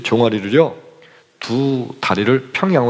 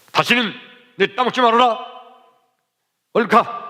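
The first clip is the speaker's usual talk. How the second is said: raised voice, a firm send-off; stern warning; 10 semitones higher than usual. Retold or imitated. Keeper hands out melons and shoos the boys, stern raised voice. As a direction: warn them off then shoo them. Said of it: "막 야단을 치시더니".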